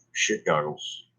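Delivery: ordinary talk, nothing marked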